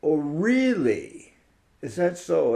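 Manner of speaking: slow, doubting "oh really"; sceptical retort. Pretend. Speaker doubting the patriotic claim, slow sceptical retort. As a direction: raise an eyebrow at the claim, slowly. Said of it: "And I would say".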